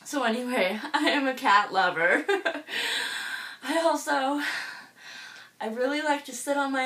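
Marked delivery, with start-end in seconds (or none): none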